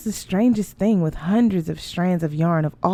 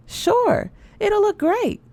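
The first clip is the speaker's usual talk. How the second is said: much higher, breezy put-on mother voice; mother's voice; slower than usual. A narrator's reading. Mother breezily brushing off daughter's doubt, high cheerful voice.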